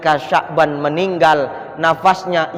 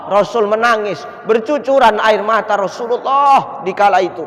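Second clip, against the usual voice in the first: normal talking voice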